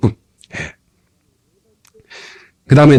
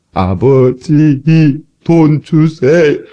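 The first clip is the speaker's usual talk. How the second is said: slow, drawn-out pleading to father; mock whiny begging; much slower than usual. Preacher mimics a gloomy believer begging God for money. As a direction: beg like a sulky child, dragging it out. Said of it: "그냥 돈 주라고 하면 되지".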